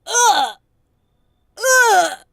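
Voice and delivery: loud, high disgusted ugh; offended loud groan. Acted. Psychic offended at being called a Leo, loud disgusted groan. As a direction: groan in outrage.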